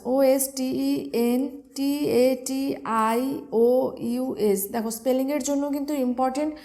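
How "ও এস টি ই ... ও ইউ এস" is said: slow, each letter spoken apart; spelled letter by letter. Shown as a demonstration. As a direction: spell it out slowly for dictation. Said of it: "syllable ভেঙে ভেঙে বলে দিচ্ছি". (ধীরে ধীরে অক্ষর ভেঙে বানান বলা)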